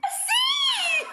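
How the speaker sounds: high, loud laughing "sim"; excited yell. Real, not acted. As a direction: shout it out with joy. Guessing letters game.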